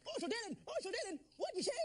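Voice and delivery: hammy celebrity impression in a freeway scene, high and pushed. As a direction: squeal it in a high, strained impression. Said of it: "do a different impression".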